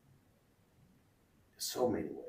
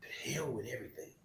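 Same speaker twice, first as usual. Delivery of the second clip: hushed, breathless whisper; whispered surrender; 3 semitones higher, 7 dB quieter than usual. Retold or imitated. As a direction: let the words barely escape. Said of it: "do I just give up and say".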